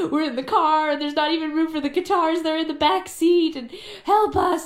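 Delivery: mock-whine for help from the back seat; whiny plea. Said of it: "Tegan or one of us being like".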